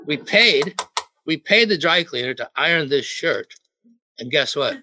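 usual speaking voice

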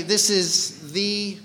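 "the" stretched long as THEE, left hanging; long, emphatic "the" as a cue; stress on THE. Shown as a demonstration. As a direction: draw out "the" and leave the noun open. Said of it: "puts emphasis on that".

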